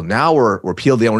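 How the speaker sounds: normal talking voice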